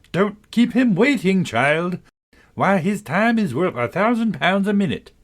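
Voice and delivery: a whole carriage nagging at once; chorus of voices. A narrator's reading. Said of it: "a great many voices all said together".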